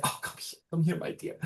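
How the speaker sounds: soft, hushed motherly comfort; gently soothe, starting almost in a whisper. Acted. Old woman pulls crying girl close, soft comforting voice. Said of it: "and the woman's like".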